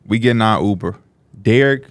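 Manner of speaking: normal talking voice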